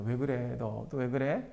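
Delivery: soft, low fatherly coaxing; coaxing dad. Retold or imitated. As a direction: quietly ask a sulking child what's wrong. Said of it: "아빠가 가서 어떻게". (달래는 아빠의 낮고 부드러운 말투)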